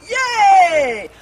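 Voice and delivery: high loud yay; joyful shout of yay; cheer. Real, not acted. Cheering the exercise.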